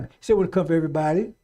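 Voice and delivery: ordinary delivery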